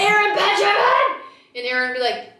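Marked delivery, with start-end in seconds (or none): 0.0-1.2 high, full-volume holler of a name; loud, pitched-up parental yell; dad yelling. Retold or imitated. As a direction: imitate Dad bellowing for his kid. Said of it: "my dad and I are yellers".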